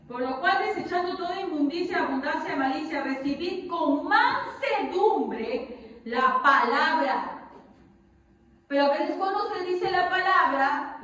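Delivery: loud, emphatic sermon delivery; forceful preaching. Acted. Preacher drives the verse home to the congregation, forceful. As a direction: preach it out to the room.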